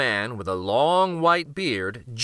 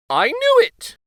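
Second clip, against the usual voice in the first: much higher, loud exclamation; king's triumphant cry; 8 dB louder than usual. Acted. King discovering a splinter, exclaiming loudly. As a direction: burst out as the king. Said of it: "he said".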